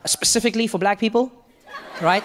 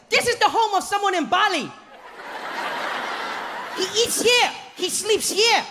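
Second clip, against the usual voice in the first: proud guide; 13 semitones higher than usual. Retold or imitated. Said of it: "he's like".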